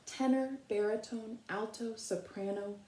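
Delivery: gentle read-aloud picture book voice, long pauses between verses; reading to children. Acted.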